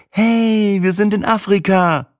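put-on high, gleeful girlfriend voice; strahlende Begeisterung. A narrator's reading. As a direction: cheer it out high, beaming with delight. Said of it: "strahlte Sina wie ein Eichhörnchen". (begeistert jubelnd)

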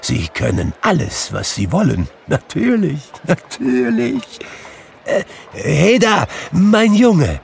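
put-on elated old miser, high swoops; giddy with joyful relief; overjoyed Scrooge. A narrator's reading. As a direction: marvel to yourself, rising into a high call. Scrooge exults, then calls the boy.

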